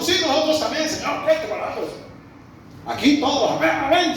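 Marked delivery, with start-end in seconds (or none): 0.0-1.8 eager crowd. Retold or imitated. Crowd eagerly agreeing with Peter. 3.6-4.2 echoing crowd. Retold or imitated.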